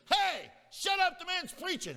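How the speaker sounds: high, loud retold outburst; angry shout. Angry student turns on girls talking during preaching, indignant shout. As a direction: yell it in full indignation, acting the fed-up student. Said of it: "I got so angry".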